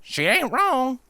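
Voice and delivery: lightly voiced farm filly agreeing; Apple Surprise agreeing. A narrator's reading. Apple Surprise siding with the princess, high twang. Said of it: "Apple Surprise concurred".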